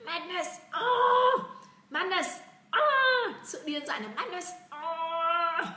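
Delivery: high-pitched "madness" with wild loud "Oh!" cries; crazed acting. Shown as a demonstration. Teacher acting out the word for students, crazed. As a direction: act out madness with high wails.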